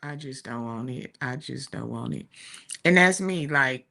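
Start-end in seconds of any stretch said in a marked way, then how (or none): 0.0-2.3 softer, a bit mumbled refusal; imitating the shy daughter, softly; quiet shy reluctance. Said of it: "She was like".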